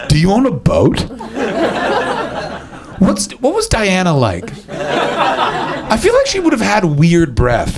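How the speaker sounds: high, slow awed questions; starstruck tourist. Pretend. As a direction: marvel like an American tourist at royalty. Mocking awe at posh English.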